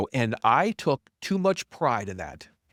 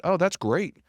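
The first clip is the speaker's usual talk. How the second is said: high-pitched mock delight; livid sarcasm; 10 semitones higher, 3 dB louder than usual. Humbled founder pretending to welcome it, livid sarcasm. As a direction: feign eager enthusiasm, dripping with sarcasm. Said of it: "Oh, no, I was livid".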